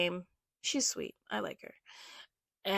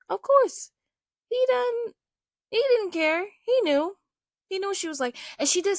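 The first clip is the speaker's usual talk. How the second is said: exasperated rant; 10 semitones higher, 7 dB louder than usual. Real, not acted. Venting anger over biased coach.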